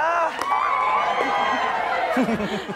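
shrieking high laugh; real high-pitched burst of laughter. Real, not acted.

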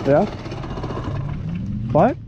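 ordinary talk

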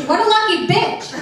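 high gleeful exclamation; mock delight. Comic reacting to viral video, gleeful high exclaim. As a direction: squeal it with fake glee.

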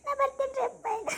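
high, crying goodbye to father; tearful farewell. Acted. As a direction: sob out a daughter's parting words.

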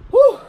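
loud, high exhausted exhale; breathless whew. Real, not acted. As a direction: blow out a tired whew. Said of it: "I'm out of breath".

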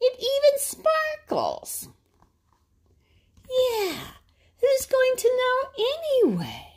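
high tempting Blue Jay voice; coaxing jay. Acted. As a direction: lure him toward the pond.

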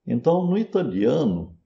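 ordinary delivery

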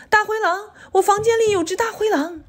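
put-on high, urgent child's voice; high-pitched acted fright; alarmed child. A narrator's reading. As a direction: cry out the alarm like a scared child. Frightened child alarms mother about wolf.

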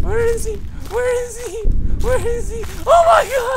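very high, frightened squeals building to a scream; panicked squeal. Real, not acted. Player searching for Slender Man, panicked squealing. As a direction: squeal it in panic, ending in a shriek. Panicking as monster hunts her.

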